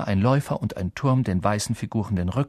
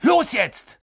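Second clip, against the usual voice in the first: high, loud, urgent orders; commanding shout; 11 semitones higher, 4 dB louder than usual. A narrator's reading. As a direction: bark the plan at the team. Harry commands the others in flight. (Harry gibt laut Befehle)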